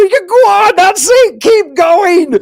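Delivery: high, loud cheering-on. Retold or imitated. Parent cheering the child on, high and loud. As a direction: cheer the kid forward.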